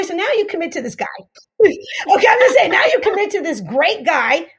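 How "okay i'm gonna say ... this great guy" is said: talking through a loud laugh. Real, not acted. Coach cracks up telling a dating story, laughing aloud. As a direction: laugh it out while you speak.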